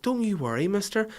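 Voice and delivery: high, soothing mock motherly voice; hammed-up comforting mum; imitating mum. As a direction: coo it like a mum reassuring a stranger. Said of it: "my mum was like".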